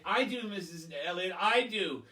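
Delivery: a keen schoolgirl begging to be picked; eager know-it-all. Retold or imitated.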